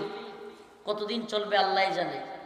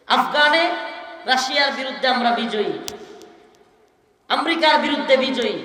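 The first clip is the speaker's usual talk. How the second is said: rising, louder victory cries with long pauses; preaching at full force; 3 semitones higher, 4 dB louder than usual. Acted. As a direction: roll the victories out to the crowd, louder each time. Fiery sermon victory roll call. (উচ্চস্বরে জোশের ওয়াজ)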